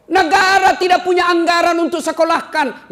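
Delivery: high, loud rhetorical cry; full-voiced pulpit exclamation; impassioned preacher's shout. Real, not acted. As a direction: thunder it out to the whole hall. Preacher thunders, then repeats it calmly.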